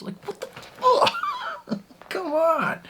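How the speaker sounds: very high, slow strained squeal of frustration; exasperated squeal. Real, not acted. Viewer fed up with the radio host, squealing in frustration. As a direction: wail it out in exasperation.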